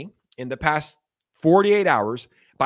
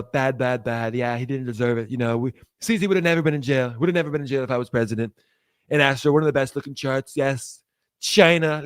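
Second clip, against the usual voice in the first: lightly voiced presidential impersonation; Trump; 2 semitones lower than usual. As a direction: do a rambling, boastful politician with emphatic repeats. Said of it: "What would Trump say".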